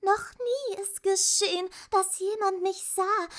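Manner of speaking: very high, monotone, chanting verse; Ujulala, bodiless voice. A narrator's reading. Ujulala tells Atreyu nobody has seen her, high chant. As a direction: intone it like an otherworldly spirit.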